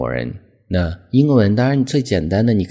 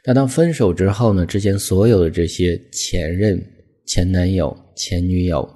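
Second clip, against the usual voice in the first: usual speaking voice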